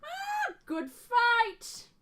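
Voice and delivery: high, loud showman's congratulation; big theatrical high-pitched cheer; Monty. Acted. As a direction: call it out in a high, put-on voice. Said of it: "you hear a familiar voice say".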